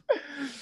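high breathy cracking laugh. Real, not acted. Host cracks up at own Venmo joke, high laugh.

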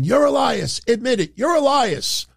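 high-pitched mock yell. Wrestler insisting on his rival's true identity, yelling. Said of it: "He starts yelling again".

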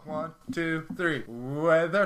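retold group cue with a long last word; counted-in catchword. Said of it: "I go".

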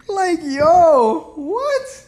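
high, loud laugh-through of disbelief. Real, not acted. Podcaster laughing at getting catfished, high and loud. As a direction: laugh it off in shock, pitch jumping up.

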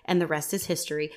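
normal talking voice

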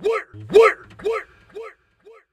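hyper, high-pitched chant; buzzing on coffee, chanting fast; too much caffeine. Real, not acted. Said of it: "I had three cups of coffee today".

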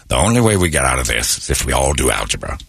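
low, measured presidential address; deep voice of a grave narrator-president; Morgan Freeman. Acted. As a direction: announce it low, like a solemn statesman. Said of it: "Morgan Freeman was president and he said".

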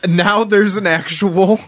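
loud, high, indignant burst; can't believe it's real, almost shouting; excited. Real, not acted. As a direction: blurt it out in disbelief. Excited outburst about the article.

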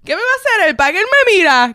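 loud, high, heated challenge; imitating Carlos fuming at the stranger; angry drunk friend. Carlos mocked as a drunk angry friend shouting at the stranger. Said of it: "Carlos estaba bravo".

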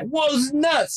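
high, astonished shout; amazed. Retold or imitated. Retelling his own shock at the casino win, surprised shout. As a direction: gasp it out in disbelief. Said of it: "And I was like".

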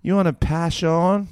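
high Australian bogan voice; bogan chick accent. Acted. Imitating bogan women, high Australian accent. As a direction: call out like a bogan Australian woman. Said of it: "Bogan chicks have the hottest accent".

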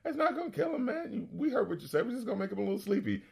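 high, soft imitation of a smooth schemer; acted-out furtive coaxing; sly reassurance. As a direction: play it down in a hushed, high voice. Quoting stranger soothing the reluctant man.

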